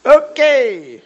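high, booming, drawn-out falling word; startled loud reaction. Real, not acted. Preacher hearing how little time is left, loud outburst. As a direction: burst out loudly, stretched and falling.

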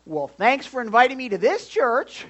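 loud, high sarcastic thanks; mock offended visitor. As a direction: huff it like an insulted guest.

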